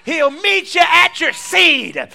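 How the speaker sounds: high, slow, full-force proclamation; preacher's shout. Real, not acted. Preacher promising congregation a return on seed, slow high shout. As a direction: shout each word out to the whole church.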